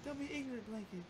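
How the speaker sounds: [name] impression. Retelling the South Park scene, high scolding impression. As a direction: scold Blanket in a high put-on voice. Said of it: "quote unquote [name]".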